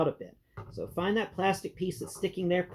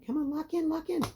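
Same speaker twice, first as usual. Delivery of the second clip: coaxing; 10 semitones higher than usual. Real, not acted. Coaxing a toy part into place.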